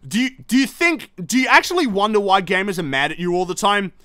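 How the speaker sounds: ordinary talk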